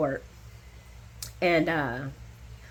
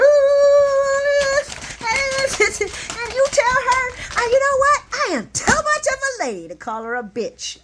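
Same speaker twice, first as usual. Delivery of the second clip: mock prim outrage; 5 dB louder than usual. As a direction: play a prim lady holding back. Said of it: "People going".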